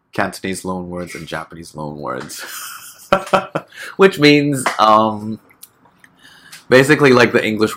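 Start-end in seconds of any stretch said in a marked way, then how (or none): 2.5-3.9 high breathy laugh. Real, not acted. Laughs at own jargon.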